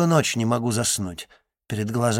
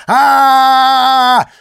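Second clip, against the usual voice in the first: high, sustained scream at top volume; long full-force scream; 14 dB louder than usual. A narrator's reading. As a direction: scream to drown the other person out. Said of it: "Посмотрим, кто громче!". (долгий истошный вопль)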